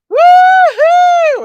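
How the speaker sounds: high loud woo-hoo; cheering whoop. Pretend. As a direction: let out a big celebratory whoop. Cheering after birthday song.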